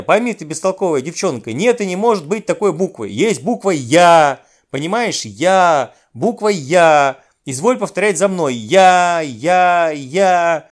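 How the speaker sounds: loud insistent drilling, the letter drawn out and belted; exasperated shouting. A narrator's reading. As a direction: hammer the letter into a slow pupil. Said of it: "закричал я".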